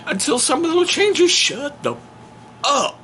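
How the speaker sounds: dad shutting down his son in a mock rant; mock outburst. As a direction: snap it out as a hammed-up rant. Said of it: "I'm like".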